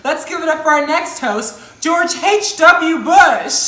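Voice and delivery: loud, drawn-out game show intro; announcer voice. Acted. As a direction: hype up the guest's name. Host announcing the next guest.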